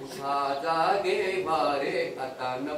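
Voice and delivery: lazy mock call. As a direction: mumble the wake-up call half-heartedly. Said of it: "ते जागेच असणारे म्हणतात".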